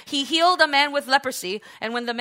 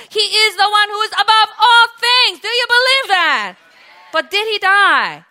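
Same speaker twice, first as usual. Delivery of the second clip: loud, high, building to a shout; fervent pulpit crescendo; full-force preaching; 7 semitones higher, 11 dB louder than usual. Real, not acted. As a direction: thunder it out to the congregation.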